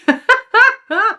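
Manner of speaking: high burst of laughter; real giggle at a slip of the tongue; laughing. Real, not acted. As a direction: laugh out loud, high-pitched.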